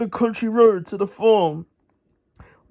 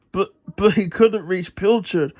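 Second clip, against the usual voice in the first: plain reading voice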